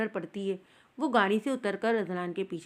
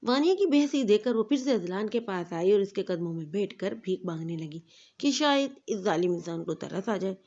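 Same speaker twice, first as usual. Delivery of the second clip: ordinary delivery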